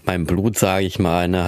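usual speaking voice

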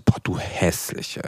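slow, breathy, affectionate mock insult; playful insult. Shown as a demonstration. Showing her friend how she teases, slow breathy mock insult. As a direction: drag it out softly like a teasing jab among friends. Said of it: "das ist nämlich aber auch nochmal die Betonung".

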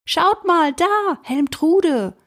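high, excited shout across the ice; excited call. Acted. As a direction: call out, thrilled. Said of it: "riss die Augen auf und rief".

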